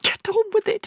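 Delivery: very high, sharp command; impatient hiss. A narrator's reading. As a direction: urge the frozen husband. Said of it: "hissed the mother".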